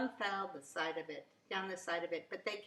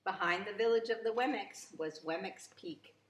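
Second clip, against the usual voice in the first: usual speaking voice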